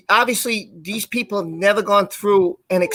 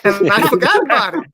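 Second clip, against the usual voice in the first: laughing; 7 semitones higher, 3 dB louder than usual. Real, not acted.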